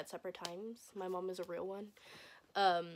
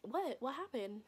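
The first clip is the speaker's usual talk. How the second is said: softly puzzled, higher voice; imitated innocent question; oblivious; 3 semitones higher, 6 dB quieter than usual. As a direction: ask it as if clueless. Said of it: "completely oblivious".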